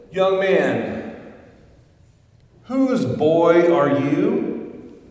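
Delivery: loud, slow, probing call across the church; booming slow question to a stranger; preacher calling out. Acted. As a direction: call out to the boy from the pulpit, drawn out. Preacher calls out to boy.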